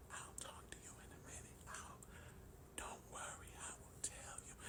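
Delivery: hushed, voiceless whisper. Retold or imitated. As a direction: imitate a wife whispering because her throat hurts. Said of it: "she's whispering".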